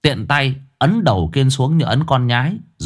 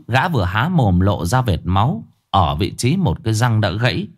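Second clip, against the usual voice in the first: plain reading voice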